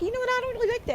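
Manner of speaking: hammed-up high voice of the rival; mocking rival impression. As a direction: squeak it out to mock her. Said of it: "she talks like this".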